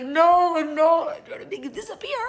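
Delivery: high, squealed denial breaking into a laugh; mock protest. Answering his joke about disappearing, squealed mock protest. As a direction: start loud and high, climb into a thin laughing squeal.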